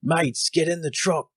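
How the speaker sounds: high, loud Australian shout calling the party; hammed-up Australian rescuer yelling from the Jeep. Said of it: "you hear this voice go".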